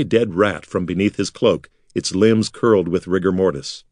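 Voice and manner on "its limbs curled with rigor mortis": ordinary delivery, nothing marked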